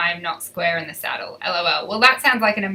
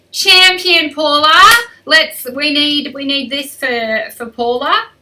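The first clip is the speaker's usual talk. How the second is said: loud, high cheer for a viewer; excited shout-out to a follower; 6 semitones higher, 9 dB louder than usual. Real, not acted. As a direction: cheer her name out loud.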